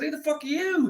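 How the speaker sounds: high, loud, frightened demand; panicked shout. Retold or imitated. To the stranger touching him: high panicked yell.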